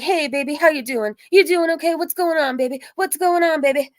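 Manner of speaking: high-pitched mocking come-on; imitated catcaller. Catcaller to crying drunk woman, mocking impression of his come-on. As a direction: mimic a pushy catcaller, pitched right up. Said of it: "how he talks".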